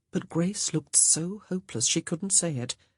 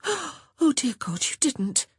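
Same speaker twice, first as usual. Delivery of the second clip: shocked gasp; 10 semitones higher, slower than usual. A narrator's reading. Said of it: "Honor gasped".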